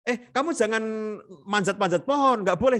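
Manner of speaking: high-pitched, acted parental nagging; forbidding parent. Parent calling a child in at night, high insistent nagging. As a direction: call the child off with insistent prohibitions. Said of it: "cuma orang tua itu".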